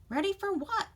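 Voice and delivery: put-on high-pitched puzzled young fawn; questioning fawn. A narrator's reading. Fawn questioning the chickadee, high and puzzled. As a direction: lift it high, baffled. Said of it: "questioned the fawn".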